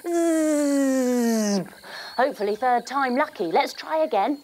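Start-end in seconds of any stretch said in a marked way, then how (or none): 0.1-1.6 buzzy zip noise; zipper sound. Acted. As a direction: buzz like a tent zip. Zipping the tent down.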